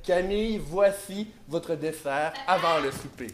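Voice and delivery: low, slow, soft butler. Acted. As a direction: serve it like a polite valet. Improv, plays a butler serving. (voix de majordome grave et lente)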